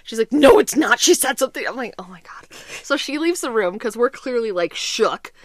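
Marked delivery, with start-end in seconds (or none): 0.3-1.7 loud mock accusation; insistent retort. Wife rejects the joke excuse, retold loud insistence. 2.0-2.5 very soft, low aside; hushed dismay. Retold or imitated. Joker sees the prank backfire, hushed low dismay.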